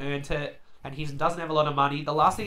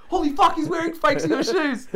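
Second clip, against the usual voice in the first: much higher and louder, bursting out; excited kid outburst; 13 semitones higher, 6 dB louder than usual. Retold or imitated. Spotting fakes of his own shoes, excited kid outburst. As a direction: let the inner ten-year-old explode. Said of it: "The excited ten-year-old in me".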